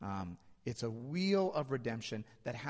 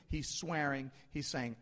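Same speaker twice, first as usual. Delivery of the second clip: ordinary delivery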